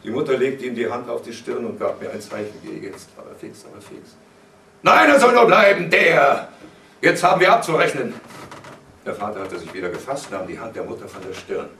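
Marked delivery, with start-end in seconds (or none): 2.6-4.2 hushed, much softer warning; quiet urging to flee; urgent aside. A narrator's reading. Mother secretly signals son to leave. 4.8-8.1 loud, high, commanding shout; furious confrontation at full voice; angry father. A narrator's reading. Enraged father stops son leaving.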